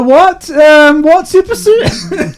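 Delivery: high, loud comic comeback; acted puzzled reply. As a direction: play innocent in a high put-on voice. Acted reply to the shouted quote.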